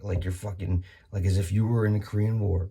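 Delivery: ordinary talk, nothing marked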